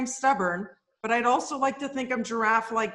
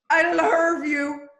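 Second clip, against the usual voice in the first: slow, louder, higher Celine croon; [name] impression; 5 semitones higher, 5 dB louder, much slower than usual. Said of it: "That's a Canadian".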